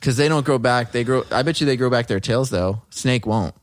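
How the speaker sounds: ordinary talk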